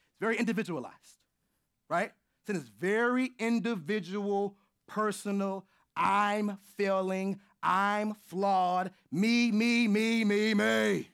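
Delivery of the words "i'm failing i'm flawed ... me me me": mocking. Mocking self-centred view of sin.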